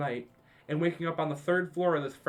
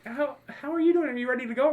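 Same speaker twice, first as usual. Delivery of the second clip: mom's voice; 10 semitones higher, 5 dB louder than usual. Retold or imitated. Mom waking her hungover son by phone, high and sweet.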